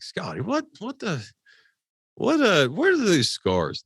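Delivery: high, puzzled "what the" asking about the scars; baffled question. Retold or imitated. Asking friend about his scars.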